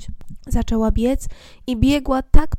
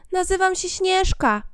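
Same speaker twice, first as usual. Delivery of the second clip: put-on young girl voice; 8 semitones higher than usual.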